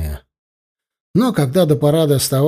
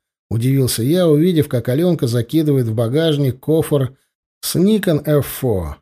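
ordinary delivery, nothing marked

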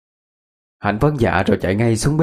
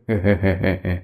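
teasing chuckle; 2 semitones lower than usual. A narrator's reading. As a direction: chuckle mischievously. Husband jokes about teasing the couple.

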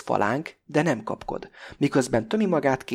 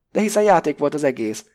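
loud, higher cry of disbelief; shouted; 4 semitones higher, 7 dB louder than usual. A narrator's reading. Pakó shouts back at Krestopulosz, loud and high. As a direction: shout it out, eyes bulging. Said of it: "kiáltja Pakó kimeredő szemmel".

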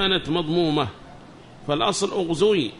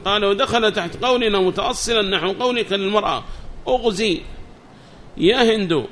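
higher, louder recitation of a book; reading text aloud; 4 semitones higher, 4 dB louder than usual. Acted. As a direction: read the text out to the class, raised and measured. (قراءة المتن بصوت مرتفع)

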